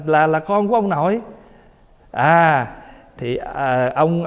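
usual speaking voice

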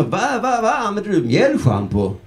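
high-pitched incredulous question; mock astonishment. Friend quizzing him about shampoo, mock astonished. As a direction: stammer in comic disbelief.

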